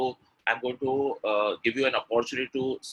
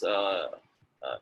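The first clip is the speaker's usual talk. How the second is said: usual speaking voice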